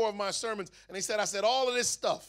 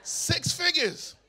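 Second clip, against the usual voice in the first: higher, louder, very slow and laughing; amazed delight; 8 semitones higher, 5 dB louder, much slower than usual. Real, not acted. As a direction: stretch it out in laughing disbelief. Amazed at the settlement offer.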